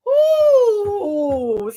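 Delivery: a loud, high, drawn-out ooh; excited ooh. Real, not acted. Reviewer thrilled by the villain, loud high ooh. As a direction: relish the drama in one loud ooh.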